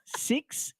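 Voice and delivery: spaced-out counting in a put-on Indian accent. Mother imitating her son reciting a fake number, Indian accent. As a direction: count one by one, lilting upward, Indian accent. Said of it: "in an Indian accent that".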